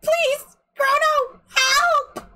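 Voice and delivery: high, frightened pleading; panicked high cry. Acted. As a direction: beg for help in terror. Acted panicked girl in pain.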